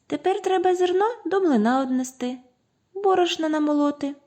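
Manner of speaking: very high, quick squeaky voice; mice in unison. A narrator's reading. Mice tell rooster about the mill, squeaky. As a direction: chirp it like eager little mice. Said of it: "кажуть в один голос".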